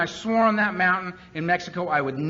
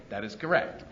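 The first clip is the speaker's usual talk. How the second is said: deadpan; 7 semitones lower, 5 dB quieter than usual. Retold or imitated. Sponsor's dry reply.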